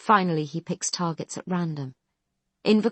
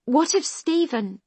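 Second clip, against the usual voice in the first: much higher, slow, pleading protest; 11 semitones higher, 4 dB louder than usual. A narrator's reading. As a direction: cut in desperately. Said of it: "Doctor Khan protests".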